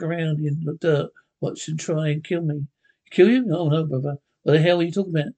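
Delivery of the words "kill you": surprised. Acted. Accused of wanting to kill.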